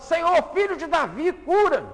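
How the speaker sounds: high, loud desperate yell. Acted. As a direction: shout above everyone. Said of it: "começa a gritar mais alto".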